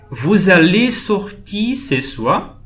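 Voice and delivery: slow, with "soir" swept sharply up; teacher's slow model question, rising high on "soir"; rising question intonation. Shown as a demonstration. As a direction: lift the end on "ce soir" into a question. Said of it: "a entonação é um pouquinho diferente".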